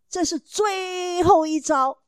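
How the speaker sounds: slow, tearful, voice catching; a mother near tears as she admits it; choked up. Real, not acted. Mother tearfully telling parents about the last resort, slow and choked.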